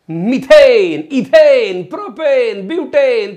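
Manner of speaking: loud, high chant with falling ends; punchy, rhythmic teacher's chant; shouted drill. Shown as a demonstration. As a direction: belt out each name like a class drill. Chants alkane names for class.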